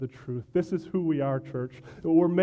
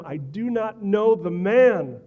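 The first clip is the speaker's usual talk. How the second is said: louder, higher and slow, each word hammered; slow forceful denial; 4 semitones higher, 6 dB louder, slower than usual. Acted. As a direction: spell it out with a raised voice. Peter curses and swears.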